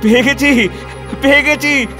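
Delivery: high, exultant declaiming; jubilant discovery. Acted. Reformer finds the verse backing widow remarriage, jubilant. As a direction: cry out the find in triumph, pitch held high.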